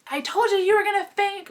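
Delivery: high, loud scolding; imitated told-you-so. Friend scolds her after the faint.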